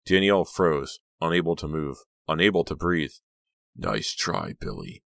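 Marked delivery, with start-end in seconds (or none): 3.8-5.0 low, soft, tight anger; contained anger at a rival. A narrator's reading. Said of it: "Kit's voice sounded tight with anger".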